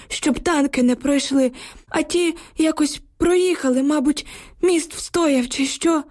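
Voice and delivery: an old neighbour gasping out bad news; frightened old villager. A narrator's reading. Old neighbour tells the women about the bridge, anxious. Old man Yukhym voiced.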